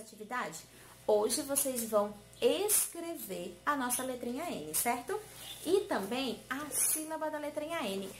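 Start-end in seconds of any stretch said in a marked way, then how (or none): none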